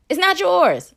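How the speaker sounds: high, loud and final; emphatic retort. Real, not acted. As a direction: slam it down, no debate.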